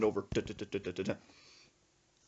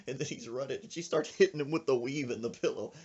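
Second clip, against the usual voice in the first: ordinary delivery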